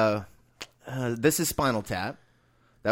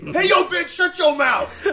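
much higher, loud, brash yell; rude heckler shout; 5 dB louder, slower than usual. Retold or imitated. Mock heckler telling the woman on the phone to shut up. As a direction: shout her down with no manners.

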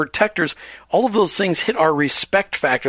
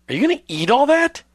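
high, judging question; mock incredulous husband; 9 semitones higher than usual. Host voicing a husband questioning his wife's plate, mock incredulous. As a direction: pitch leaps up in staged disbelief.

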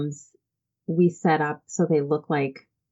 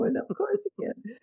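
much softer, higher, voice breaking; tearful repeat; 9 semitones higher, 8 dB quieter, faster than usual. Real, not acted. Repeating her answer to the mother while crying. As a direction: whisper it through tears. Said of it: "because I'm crying right now".

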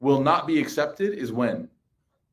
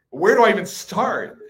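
raised, louder exasperated question; 7 semitones higher, 6 dB louder than usual. Retold or imitated. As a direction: throw up your hands at the question. Father retelling his exasperation.

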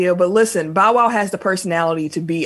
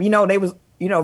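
ordinary talk, nothing marked

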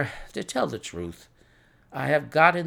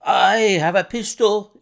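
high, loud, frightened warning; reedy, quivering voice; 6 semitones higher, 7 dB louder than usual. A narrator's reading. Frightened man warns visitors from the dark, quivering. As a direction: warn them in a quivering, reedy cry. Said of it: "came a reedy, quivering voice".

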